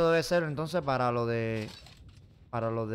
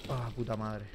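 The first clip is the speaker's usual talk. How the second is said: cursing under breath; 4 semitones lower, 13 dB quieter than usual. Real, not acted. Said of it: "Me han asustado".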